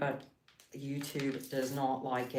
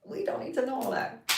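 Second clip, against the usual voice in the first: mock dismay; 9 semitones higher than usual. Repeating line, pitched up.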